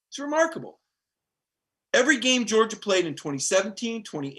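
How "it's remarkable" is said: much higher, marveling; real astonishment; amazed. Real, not acted. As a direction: stress remarkable in wonder. Repeated amazement.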